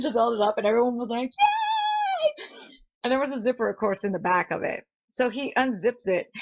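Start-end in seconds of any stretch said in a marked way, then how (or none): 1.3-2.5 very high drawn-out cheer; imitated crowd cheer.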